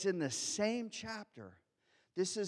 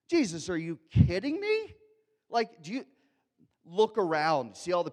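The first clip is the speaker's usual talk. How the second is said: louder, incredulous disciple voice; exasperated disbelief; 7 dB louder than usual. Retold or imitated. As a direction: ask it like they can't believe him.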